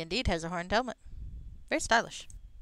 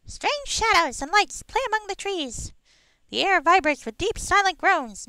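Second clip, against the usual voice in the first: high squeaky pixie narration; 13 semitones higher, 10 dB louder, slower than usual. Pretend. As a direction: read it like a tiny fairy. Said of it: "my pixie voice".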